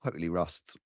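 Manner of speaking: low rueful mumble; mumbled aside. Shown as a demonstration.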